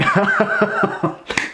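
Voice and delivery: high, breaking into helpless laughter; amused burst of laughter; real laugh. Real, not acted. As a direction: laugh at the memory. Recalls laughing so hard.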